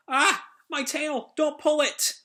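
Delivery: high yelps about her tail; pained cry. Retold or imitated. Puppet yelping as Sonic steps on her tail. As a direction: cry out as your tail is stepped on.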